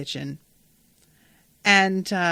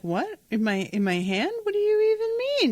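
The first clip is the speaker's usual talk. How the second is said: feigned innocence; 13 semitones higher than usual. Retold or imitated. Aunt feigning innocence to Eamon.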